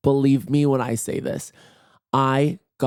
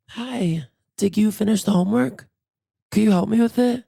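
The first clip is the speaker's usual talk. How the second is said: higher-pitched imitation of her raspy childhood self; raspy kid voice; 8 semitones higher than usual. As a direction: play a raspy little kid asking for help. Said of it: "I had a raspy voice".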